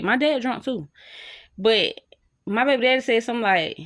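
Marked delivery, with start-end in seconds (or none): none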